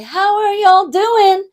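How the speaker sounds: high, loud, drawn-out crowd greeting; big, slow, high-energy opener; rousing stage greeting. Acted. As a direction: belt it out to hype the audience. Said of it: "people will come out and say".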